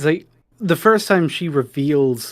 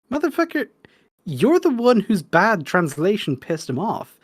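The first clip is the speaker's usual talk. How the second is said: exasperated rebuke; 8 semitones higher than usual. Acted. Acted exasperated rebuke of David.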